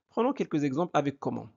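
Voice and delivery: ordinary delivery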